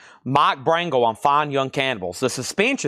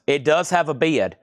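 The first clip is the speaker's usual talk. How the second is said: ordinary delivery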